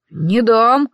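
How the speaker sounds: usual speaking voice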